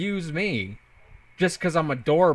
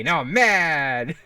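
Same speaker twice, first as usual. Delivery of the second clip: slow, louder pretend anger; mock mad; 5 dB louder, much slower than usual. Streamer to chat, teased as a twink, hammed-up mock anger. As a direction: drag out the words in hammed-up fury. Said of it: "Look at me".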